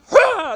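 high, loud whooping burst; jolted cry. Acted. Rider jolted at the rope's end, loud high cry. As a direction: explode as if yanked hard.

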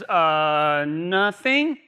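low drawn-out uh before an innocent nothing; evasive nothing. Retold or imitated. Imitating what scares me whenever my wife says it, evasive. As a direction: mimic a wife stalling with a long uh. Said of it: "whenever my wife says".

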